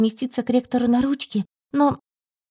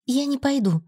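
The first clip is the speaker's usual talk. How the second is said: higher-pitched, understated resolve; resolute; 4 semitones higher than usual. A narrator's reading. Heroine refusing the blond team leader, resolute. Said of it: "заявила решительно и остановилась".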